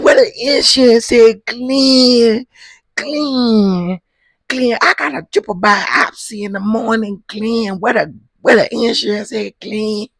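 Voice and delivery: high, loud hammed-up sobbing plea; wife mock-crying at Glenn over the insurance; mock crying wail. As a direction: wail the question like a fake cry. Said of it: "That's how she said it".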